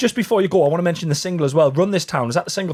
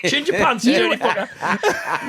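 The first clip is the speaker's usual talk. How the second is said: mock outrage; 7 semitones higher than usual. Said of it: "I'd be like".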